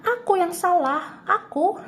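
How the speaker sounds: half-playful wail of self-blame; mock yell. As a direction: cry out in pretend frustration, pitch way up. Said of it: "kayak pengen teriak aja".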